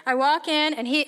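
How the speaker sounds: normal talking voice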